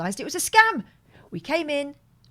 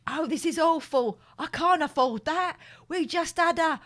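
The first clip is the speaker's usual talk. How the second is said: rising distressed wail, higher; upset; 5 semitones higher, 4 dB louder than usual. Acted. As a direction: act worried sick about the money. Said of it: "You think I'm acting now?".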